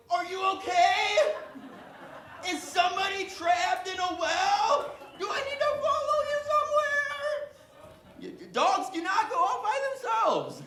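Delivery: hammed-up worry, shouting for the lost dog; mock alarm. Spotting a dog alone in the neighborhood, loud mock panic.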